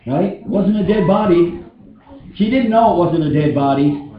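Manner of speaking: ordinary talk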